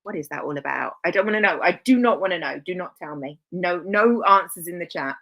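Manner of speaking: ordinary delivery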